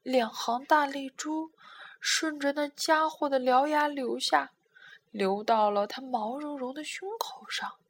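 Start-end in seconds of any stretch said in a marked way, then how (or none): none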